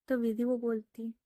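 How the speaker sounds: ordinary delivery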